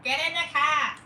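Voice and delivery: loud, high Boston bark; Boston accent. Acted. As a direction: shout it like a Boston mom. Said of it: "thick Boston accent".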